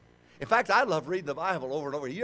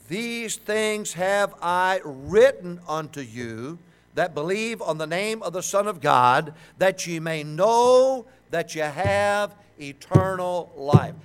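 very slow, louder solemn reading; scripture reading; 3 semitones higher, 8 dB louder, much slower than usual. Acted. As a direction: weigh each word of the verse. Reads scripture slowly.

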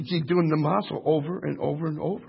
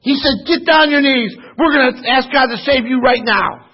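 loud, high, shouting preacher cadence; fiery revival sermon shout; full-force preaching; 9 semitones higher, 9 dB louder than usual. Acted. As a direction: preach it out at the top of your voice. Preacher retells a revival story.

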